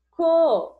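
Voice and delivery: co sung out high and loud; syllable called out. Shown as a demonstration. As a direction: call out co as one beat.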